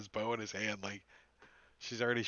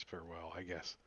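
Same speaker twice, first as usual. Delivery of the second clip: quiet shrug; 5 semitones lower, 12 dB quieter than usual. Acted. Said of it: "kinda says".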